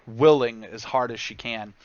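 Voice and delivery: ordinary talk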